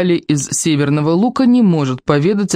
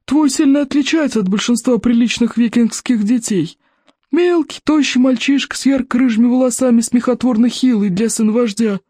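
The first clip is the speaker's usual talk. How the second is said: put-on cranky old librarian; 8 semitones higher than usual. A narrator's reading. Голос библиотекаря. (сварливый старик-библиотекарь, высоко и протяжно)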